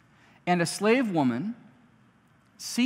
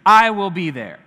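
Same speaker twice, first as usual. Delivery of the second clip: fervent shout; 8 semitones higher, 10 dB louder than usual. Retold or imitated. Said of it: "He's like".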